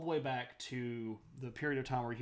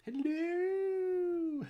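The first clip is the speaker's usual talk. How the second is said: playful hello; 5 dB louder than usual. Real, not acted. Greeting a viewer.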